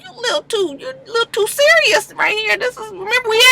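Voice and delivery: high, careful mock guard voice; high-pitched imitation of security stepping in; security guard caution. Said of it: "the security was just like".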